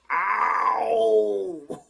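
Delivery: sharp yelp of pain; real cry of sudden pain. Real, not acted. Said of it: "It hit my funny bone".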